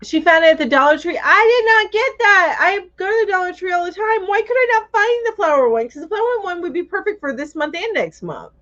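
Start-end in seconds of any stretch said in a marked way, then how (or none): 1.2-8.5 high complaining whine; whining. Real, not acted. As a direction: whine about missing out. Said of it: "I'm whining".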